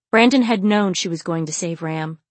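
ordinary delivery, nothing marked

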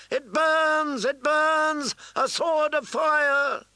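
high, loud frenzied shouting. A narrator's reading. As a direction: chant it at the top of your lungs. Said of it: "took up the cry".

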